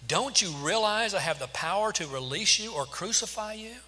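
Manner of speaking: high, pressing, incredulous question; demanding. A narrator's reading. Pilate asserting his power over Jesus, demanding. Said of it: "Pilate demanded".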